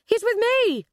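loud, high yell in someone's defence; urgent shout. A narrator's reading. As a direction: shout to call off an attack. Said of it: "shouted Sally".

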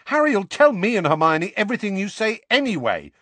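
put-on high, loud, heated boy; hot-tempered. A narrator's reading. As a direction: argue back hotly. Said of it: "said Ron hotly".

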